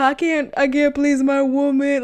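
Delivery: lightly mocked whiny boyfriend; mocking whine. Girlfriend mocking boyfriend's complaint, light whine. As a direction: echo his whine with scorn. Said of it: "Talk about".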